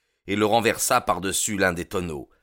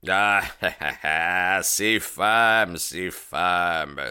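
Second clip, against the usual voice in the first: drawn-out, theatrical lament; sighing; much slower than usual. A narrator's reading. Boss holding both rivals by the shoulders, sighing. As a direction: groan it out, then let it sink. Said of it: "soupira-t-il".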